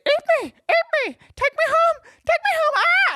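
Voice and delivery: very high, loud squeaky tempting voice; talking croissant. Pretend. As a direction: squeal like a pastry begging to be eaten. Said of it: "and it's like".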